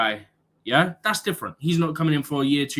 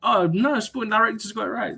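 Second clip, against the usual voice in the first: higher-pitched mocking quote; sarcastic; 7 semitones higher than usual. Football fan mocking those who back the Sporting Director, sarcastic. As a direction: mimic a naive defender of the club, sarcastically. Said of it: "I'm being sarcastic".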